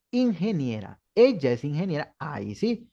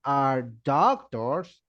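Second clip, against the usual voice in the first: very slow model phrase; teacher says the example very slowly; 9 semitones higher, much slower than usual. Shown as a demonstration. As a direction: drag it out for the class. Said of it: "si usted dice".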